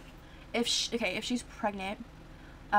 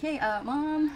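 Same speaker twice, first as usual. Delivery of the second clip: slightly higher, drawn-out call to mom; hesitant teen daughter; 6 semitones higher, 4 dB louder, much slower than usual. Acted. Daughter breaking news to mother.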